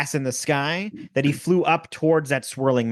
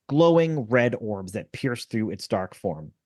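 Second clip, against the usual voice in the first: ordinary talk, nothing marked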